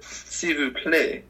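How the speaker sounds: slow, drawn-out mock French accent; exaggerated French accent like a quiz host. Mocking Alex Trebek's French accent on air. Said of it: "he has to do it in some French accent".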